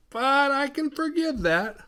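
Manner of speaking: high, slow, drawn-out mimicry; put-on high forgiving voice; sudden mock forgiveness. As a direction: sing it out high and loud, all forgiving. Retold sudden forgiveness after accusations.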